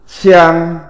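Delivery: xiāng on a long level tone; Mandarin pronunciation xiāng. Shown as a demonstration. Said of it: "Phiên âm tiếng Trung đọc là".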